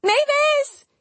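very high, loud panicked call; crying out. A narrator's reading. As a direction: yell it out at the top of your range. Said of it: "she cried".

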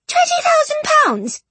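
sky-high voice of disbelief; astonished squeal. A narrator's reading. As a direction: squeal the sum in amazement. Helen astonished at the fortune.

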